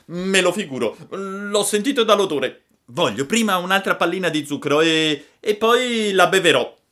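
put-on high, loud bargaining child; Pinocchio capriccioso. A narrator's reading. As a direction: stall noisily in a high puppet voice. (voce acuta da bambino capriccioso)

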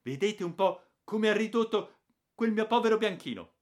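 aunt displaying the ruined dog in outrage; indignant aunt. A narrator's reading. Aunt Bettina complaining to the father about her dog, indignant. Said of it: "ha aggiunto la zia Bettina".

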